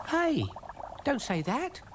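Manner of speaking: high-pitched worried little snail; high cartoon voice of alarm. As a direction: object in a high, anxious voice. Brian alarmed at experiment.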